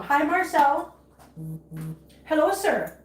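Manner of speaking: ordinary delivery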